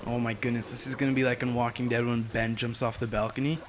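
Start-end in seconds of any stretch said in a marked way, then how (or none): none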